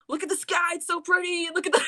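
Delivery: high-pitched imitation of upset insistence; retold yelling. Imitating Mayu pleading with him, high mock yell. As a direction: pitch it way up, mock yell without much volume. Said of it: "yelling, like".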